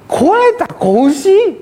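high, drawn-out disbelief; incredulous brother. Retold or imitated. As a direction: stretch it out, can't believe it. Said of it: "ここに引っかかってるんですよ".

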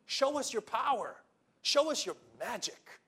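mock them into proving it; a challenging cry for a sign; demanding crowd.

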